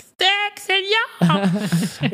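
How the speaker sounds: very high mock shrill yell. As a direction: call out shrilly across the hall. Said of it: "woman yelling had this really shrill voice".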